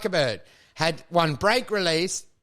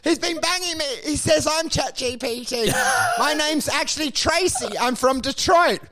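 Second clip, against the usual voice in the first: high-pitched mock victim pleading its case; escaped AI bot; 7 semitones higher than usual. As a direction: plead to strangers in a put-on high voice. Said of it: "And they go like".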